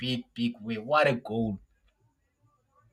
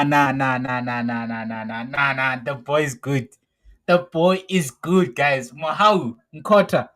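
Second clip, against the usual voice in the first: ordinary talk, nothing marked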